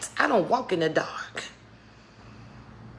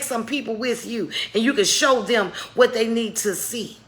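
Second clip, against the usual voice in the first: higher, louder sermon exhortation; rousing preaching; 4 semitones higher, 4 dB louder than usual. Acted. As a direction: urge them on like a pulpit call. Preaching encouragement.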